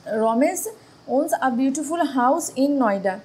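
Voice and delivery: the right sentence with owns, read slowly; slow corrected reading. Shown as a demonstration. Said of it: "এখানে হবে ওজ".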